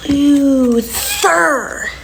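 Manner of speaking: high, drawn-out teasing loser; mocking loser taunt.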